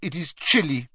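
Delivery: put-on icy, French-accented lady; cold voice. A narrator's reading. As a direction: chill every word. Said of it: "as cold as her voice".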